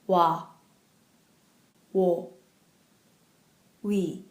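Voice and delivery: Wa, Wo, We said slowly and clearly; pron demo. Shown as a demonstration. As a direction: model each Korean w-vowel for learners to repeat. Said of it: "So listen and repeat".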